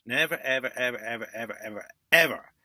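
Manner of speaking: each ever stronger, last one loud and sharp; insistent warning rising to a loud final ever; building emphasis. Real, not acted. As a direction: hammer the repeated ever up to a shout on the last.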